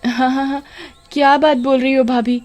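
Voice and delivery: high, sugary, nervous denial; fake innocence. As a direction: sweet-talk to cover a lie. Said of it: "पोल खुल जाने के डर से". (पोल खुलने से डरी कामिनी, बनावटी मीठी सफ़ाई)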